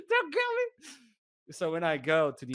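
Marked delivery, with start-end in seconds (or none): 0.1-0.7 tiny high squeak of pleading; mock terror. Passenger begs armed guard, comically terrified.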